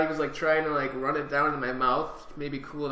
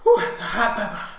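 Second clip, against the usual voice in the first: louder, higher, laughing through the heat; 5 semitones higher, 8 dB louder than usual. Real, not acted. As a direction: laugh it out, breathless from spice.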